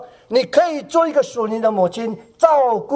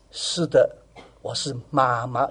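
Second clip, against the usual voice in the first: tender whisper-soft reply; 9 semitones lower, 5 dB quieter than usual. Pretend. Gentle mother answering crying girl.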